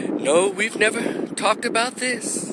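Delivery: high, slow, mild voice; meek wife. Retold or imitated. Wife answering her husband, imitated high and meek. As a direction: imitate a timid wife, drawing it out. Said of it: "real soft and meek and mild".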